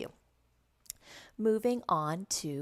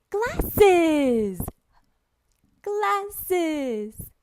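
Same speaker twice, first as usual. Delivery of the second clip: high, loud, stretched-out amazement; gushing kids' teacher drill; excited word modelling; 11 semitones higher, 8 dB louder than usual. Shown as a demonstration. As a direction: exclaim the word like a wonderful surprise. Shows real glasses to imagined child.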